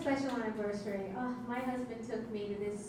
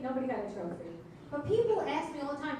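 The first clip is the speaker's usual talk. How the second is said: ordinary delivery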